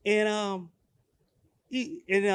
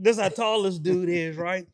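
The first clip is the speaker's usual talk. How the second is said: ordinary delivery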